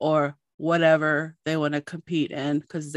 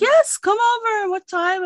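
high, loud and eager; bubbling over with excitement; excited; 6 dB louder than usual. Retold or imitated. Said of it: "she was so excited".

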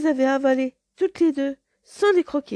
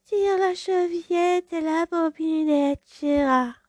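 higher, slow, sugary wolf; sweetened voice; 10 semitones higher, slower than usual. Acted. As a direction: coo it as sweetly as a wolf can. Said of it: "une voix aussi douce que possible".

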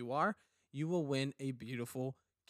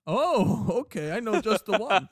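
laughing husband; 8 semitones higher, 9 dB louder than usual. Acted. Husband answers in the skit.

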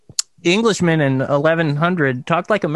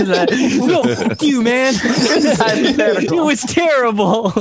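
laughing; 7 semitones higher, much slower than usual. Real, not acted. Laughing at mock argument.

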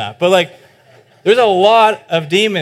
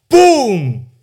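loud boom; 8 semitones higher, 7 dB louder than usual. Acted. Sudden stilling of the storm.

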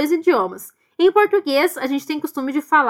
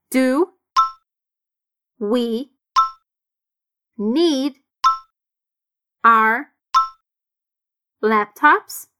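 slow, each word separate; 2 semitones lower, much slower than usual. Shown as a demonstration. Teacher breaking the question into single words. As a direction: dictate the question one word at a time. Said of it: "sons bem conectados".

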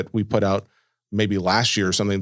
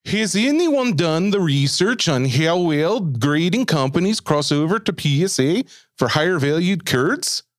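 slow, higher-pitched, lilting mock Norwegian accent; Scandinavian accent; 6 semitones higher than usual. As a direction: put on your best sing-song Scandinavian accent. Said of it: "try your best Scandinavian accent here".

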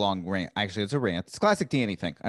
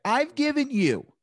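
higher, slow exasperated scolding; mad at a heckler; 8 semitones higher than usual. Shown as a demonstration. Comedian finally loses patience with the heckler, slow and exasperated. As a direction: spell out every word, fed up. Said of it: "I, like, get so mad".

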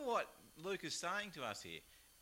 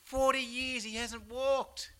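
much higher, louder astonished voice, slow; amazed crowd; 10 semitones higher, 10 dB louder, slower than usual. Retold or imitated. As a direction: sound like a stunned crowd. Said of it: "The people say".

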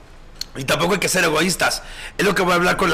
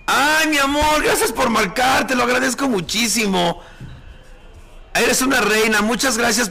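delighted; 6 semitones higher than usual. Real, not acted. Excited greeting of recognized fan.

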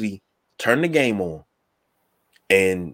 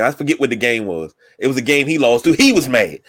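ordinary talk

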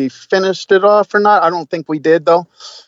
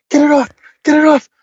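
high, louder mock panic; imitated panic; 11 semitones higher, 4 dB louder than usual. Man retelling his partner's panic, high mock-panicked voice. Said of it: "goes into a full panic".

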